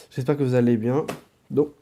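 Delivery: ordinary delivery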